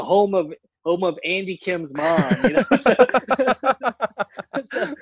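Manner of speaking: laughing through the words, real amusement; can't hold back a laugh while saying it. Real, not acted. Congressman laughing as he tells of mom's hometown sign.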